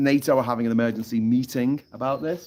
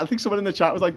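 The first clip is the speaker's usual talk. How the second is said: usual speaking voice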